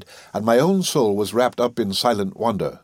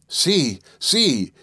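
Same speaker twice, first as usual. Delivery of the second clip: higher-pitched cry of alarm, not truly screamed; mild narrated shriek; 5 semitones higher than usual. A narrator's reading. Terrified companion shrieks to the narrator at the abyss, restrained shriek. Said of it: "shrieking in my ears".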